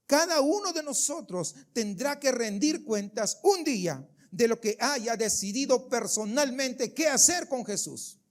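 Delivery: ordinary talk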